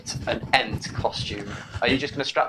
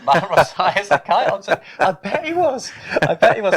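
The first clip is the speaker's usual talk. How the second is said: laughing; 6 semitones higher, 7 dB louder than usual. Real, not acted. Laughing at a chat joke.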